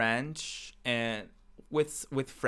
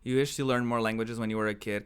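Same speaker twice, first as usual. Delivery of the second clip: ordinary talk, nothing marked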